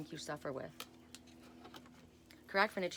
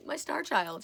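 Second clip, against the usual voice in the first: laughing fondly; 11 semitones higher, 5 dB louder, slower than usual. Real, not acted. Laughing about her daughter.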